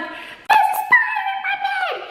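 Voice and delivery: very high frightened yell; panicked shout. Retold or imitated. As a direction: cry it out to dad in terror. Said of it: "I scream out to my dad".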